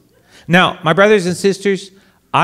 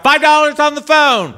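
much higher, loud auctioneer yell; auctioneer screaming; 12 semitones higher, 4 dB louder than usual. Retold or imitated. Auctioneer screams the priest's phone bid, high and loud. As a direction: belt the bid out across the room. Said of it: "And he screams out".

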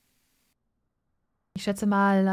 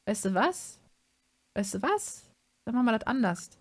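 usual speaking voice

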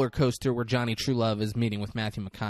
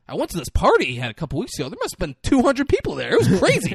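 much higher, louder, giddy party rambling; rambling Johnny Trullo; 11 semitones higher, 7 dB louder than usual. Retold or imitated. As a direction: gush about the party like a starstruck fool. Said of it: "I wish you would have been like".

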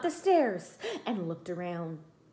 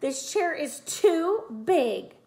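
grunting Goldilocks; 6 semitones higher, 3 dB louder, slower than usual. A narrator's reading.

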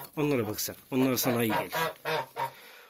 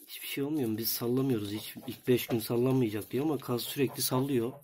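normal talking voice

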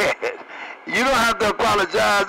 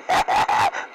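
breathy burst of laughter. Real, not acted. Trucker laughing over the CB at his joke. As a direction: laugh out at your own joke.

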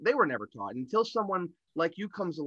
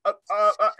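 flustered stammering; 5 semitones higher, 6 dB louder, faster than usual. Retold or imitated. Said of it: "and I'm like".

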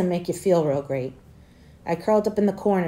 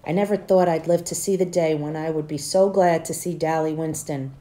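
usual speaking voice

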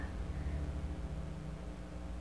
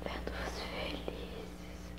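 very slow, voiceless whisper; wistful whisper; 17 dB quieter, much slower than usual. Acted. Mother imagining her children happy, wistful whisper. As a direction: fade almost to nothing, drawing it out.

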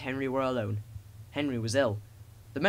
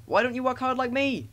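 high, cheeky taunt; whistling engine; 10 semitones higher, 4 dB louder than usual. A narrator's reading. Thomas teasing a big engine, high whistling taunt. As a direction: call it out high, like a little whistle. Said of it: "he would whistle".

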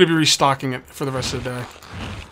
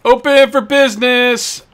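high, loud, slow announcement; cheery shopkeeper calling to passersby; 9 semitones higher, 8 dB louder, slower than usual. Acted. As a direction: sing out that the shop is open.

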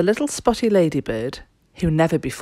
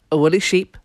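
usual speaking voice

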